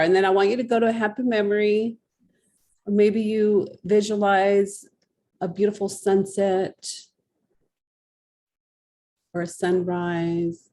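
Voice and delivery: soothing guided imagery; guided visualization. Acted. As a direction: lead her gently into a calm memory.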